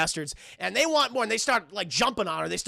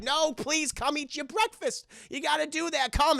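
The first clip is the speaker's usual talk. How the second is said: lightly voiced, higher mother; pleading mother; 6 semitones higher than usual. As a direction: gently nag like an anxious mother. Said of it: "your mother's like".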